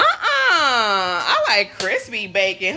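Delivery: slow, loud, drawn-out insistence; slow loud proud claim; emphatic declaration. Real, not acted. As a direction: declare it slowly, leaning hard on every word. Answering a viewer's comment.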